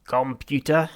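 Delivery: loud alien croak; croaking Vogon. Acted. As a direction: bark the word out as a croaking Vogon. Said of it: "he croaked".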